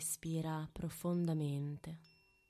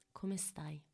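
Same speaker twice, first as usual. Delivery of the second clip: right in your ear; 5 dB quieter than usual. Acted. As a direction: close intimate whisper. Whispered check-in during meditation.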